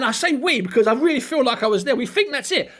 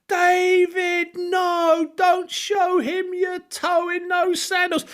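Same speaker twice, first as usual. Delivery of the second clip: mock pleading; 8 semitones higher, much slower than usual. Mocking pleading with Haye.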